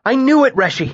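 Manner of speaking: high, emphatic burst. A narrator's reading. Bast cuts in on Kvothe with certainty, emphatic. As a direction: insist on it, pitch way up. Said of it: "he said emphatically".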